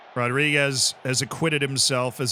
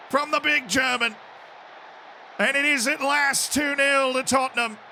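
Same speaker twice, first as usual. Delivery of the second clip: high, loud commentator goal call; long goal yell; 12 semitones higher, 4 dB louder than usual. Real, not acted. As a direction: scream the goal like a broadcaster. Goal scored, commentator-style call.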